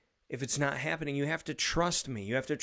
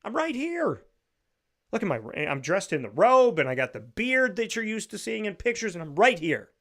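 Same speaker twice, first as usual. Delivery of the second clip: much higher and louder, a big showy God voice; giant booming God; 8 semitones higher, 5 dB louder than usual. Retold or imitated. Mocking the idea of a booming God, loud and high. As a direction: ham it up as a grand look-at-me God. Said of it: "It wasn't this giant".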